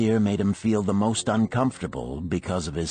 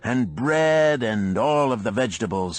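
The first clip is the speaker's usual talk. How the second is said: higher, louder booming trader calling out orders; brash orders; 7 semitones higher, 3 dB louder than usual. A narrator's reading. Dabasir ordering the innkeeper about, loud and brash. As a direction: bark his food order across the room. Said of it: "addressed him with his usual freedom".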